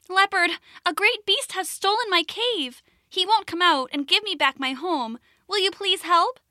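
high, pleading small rabbit; high little rabbit voice. Acted. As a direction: ask for help in a high rabbit voice.

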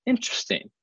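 high, soft, knowing "Interesting"; a soft, high, teasing suspicion; mock suspicious. Retold suspicious reaction.

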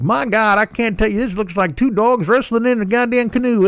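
a Southern TikTok lady gushing; mock Southern woman. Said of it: "Southern woman".

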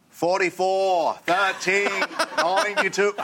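put-on high, slow quarterback call in a New England accent; calling the snap. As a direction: bark out the signals like a hammy quarterback. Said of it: "And I go".

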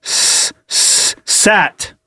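sounding out sat letter by letter; s- s- sat, slowly blending; sounding out words. Real, not acted. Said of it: "seg-, what?".

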